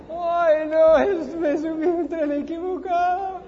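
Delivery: high, loud, excited panic; panicked retelling of the speeding train; rising alarm. Retold or imitated. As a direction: tell it breathlessly as the ground rushes past. Realizes wrong train, panics.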